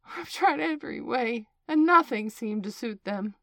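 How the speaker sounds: high, loud plaintive complaint; half-sobbing. A narrator's reading. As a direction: wail it with a touch of a sob. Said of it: "with a kind of sob".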